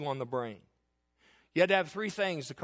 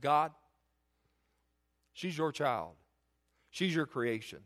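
tearful prayer; 3 dB quieter, slower than usual. Retold or imitated. Praying while crying, retold.

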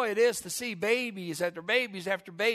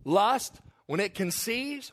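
ordinary delivery

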